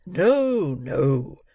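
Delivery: loud, drawn-out first word, falling away; reassuring old gentleman. Acted. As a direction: brush the worry aside emphatically.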